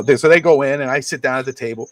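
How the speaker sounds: ordinary talk, nothing marked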